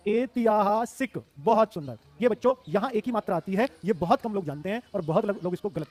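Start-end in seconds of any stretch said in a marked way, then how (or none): 0.1-1.2 ऐतिहासिक drawn out syllable by syllable; slow dictation. Shown as a demonstration. Teacher dictates the word to students, slowly. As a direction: stretch each syllable of ऐतिहासिक.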